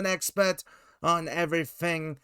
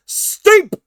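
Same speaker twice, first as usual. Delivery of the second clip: ring announcer; 12 semitones higher, 9 dB louder than usual. Pretend. Host introduces guest, announcer-style.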